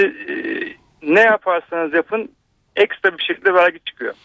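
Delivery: usual speaking voice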